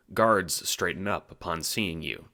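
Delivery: ordinary talk, nothing marked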